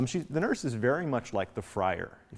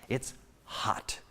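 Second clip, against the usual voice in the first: whispering; 4 dB quieter than usual. Real, not acted. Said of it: "dramatic hush".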